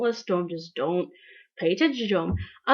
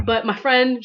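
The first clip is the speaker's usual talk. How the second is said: ordinary talk, nothing marked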